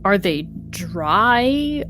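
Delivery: ordinary delivery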